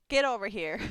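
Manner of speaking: usual speaking voice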